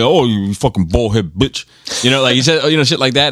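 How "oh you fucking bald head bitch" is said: imitating Joel's voice. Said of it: "and he talks like this".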